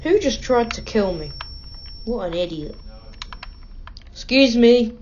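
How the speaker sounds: ordinary delivery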